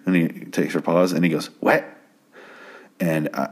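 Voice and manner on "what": abrupt "What?" with the vowel pushed toward "wet"; imitating odd "What". Caller mimicked after his pause on the phone, odd "What?" said as "wet". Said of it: "laughed hysterically at the way he says".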